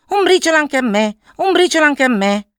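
high, loud chattering magpie; gazza supplicante. A narrator's reading. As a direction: beg in a high-pitched bird voice. Same begging magpie returns. (gazza che supplica con voce acuta)

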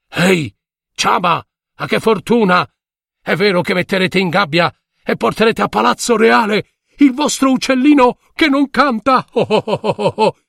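high, fast mocking taunt; teasing villagers. A narrator's reading. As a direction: jeer and laugh at him. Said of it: "Tutti lo prendevano in giro". (voce canzonatoria)

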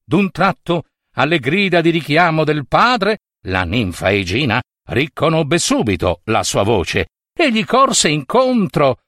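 ordinary delivery

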